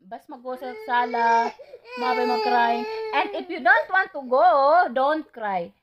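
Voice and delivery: firm scolding. Real, not acted. Scolding the crying child loudly. (nanghinaw nga nagbadlong sa bata)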